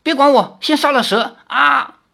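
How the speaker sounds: very high, loud alarmed cry; alarmed shout. A narrator's reading. Li Xiaoyao urges Lin Yueru to kill the snake, alarmed shout. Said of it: "李逍遥惊道".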